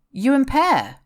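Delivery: can't believe what he just heard; disbelief. A narrator's reading. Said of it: "Chris interrupted in disbelief".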